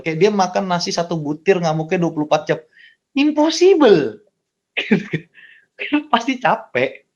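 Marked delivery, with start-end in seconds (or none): 3.1-4.3 a long, high, drawn-out impossible; mock disbelief. Real, not acted. Scoffing at a parent's claim, a high drawn-out mock disbelief. Mocking endless tantrum claim. 4.7-7.1 laughing. Real, not acted. Laughing after the joke.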